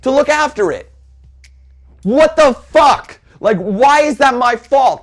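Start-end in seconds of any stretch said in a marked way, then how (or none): 2.0-5.0 slow, louder, higher exclamation; outraged disbelief. Real, not acted. Customer blamed for fraud, slow outraged disbelief. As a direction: stretch it out in disbelief.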